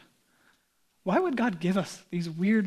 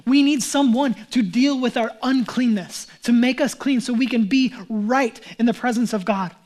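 loud, raised preaching push; fired-up sermon voice; preaching at full force; 2 semitones higher, 7 dB louder than usual. Acted. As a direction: drive the point home to the congregation.

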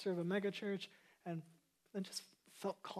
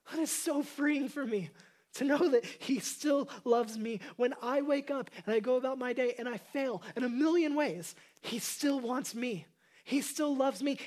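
loud, high, impassioned preaching; preaching at full force; 5 semitones higher, 8 dB louder than usual. Acted. Pastor preaching to his church, loud and impassioned. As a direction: pour it out over the congregation, full voice.

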